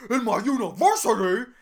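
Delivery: mock-indignant, over-enunciated complaint; worked up, angry. Student griping about her university, imitated with exaggerated outrage. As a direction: imitate an exasperated student's rant. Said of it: "And she's like".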